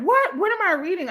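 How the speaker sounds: retold shocked outburst; shocked disbelief. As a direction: recoil at what you read. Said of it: "I was like".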